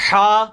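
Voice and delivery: "ہا" pushed from the middle of the throat; throaty ha. Shown as a demonstration. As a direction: breathe "ہا" from mid-throat. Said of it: "مخرج وہی ہے،".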